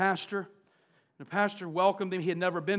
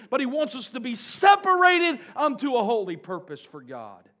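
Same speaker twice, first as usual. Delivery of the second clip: louder, pushing preacher's climax; fervent pulpit crescendo; building preaching; 4 semitones higher, 8 dB louder than usual. Real, not acted. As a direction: drive it home to the congregation. Preaching climax, rising force.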